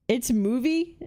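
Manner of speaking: usual speaking voice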